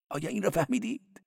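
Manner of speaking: high-voiced cleric. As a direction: play the cleric high and quick. Cleric teasing.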